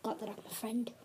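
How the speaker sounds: whispered aside. Real, not acted. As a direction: whisper it off-mic.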